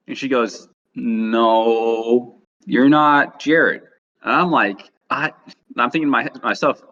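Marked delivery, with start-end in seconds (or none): 1.0-3.8 imitating a stubborn host, drawn out; insistent denial. Old lady refuses to believe Jared, imitated insistent denial.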